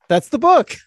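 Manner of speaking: loud, high-pitched burst of mock triumph; high, loud and gleefully over the top; mock-excited exclamation. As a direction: cry it out like a eureka moment. Excitedly cheering the mock book pitch.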